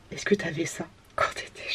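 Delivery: hushed whisper to the camera; soft conspiratorial whisper. Real, not acted. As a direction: whisper it like a secret. Whispered aside to viewers.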